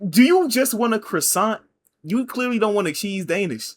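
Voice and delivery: high-pitched, loud exasperated outburst; aggressive. Retold or imitated. Barista imagining confronting the cheese Danish customer, aggressive. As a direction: push it high and hard at the customer. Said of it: "I wanted to speak that aggressively towards her".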